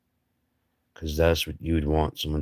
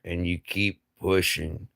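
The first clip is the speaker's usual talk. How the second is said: usual speaking voice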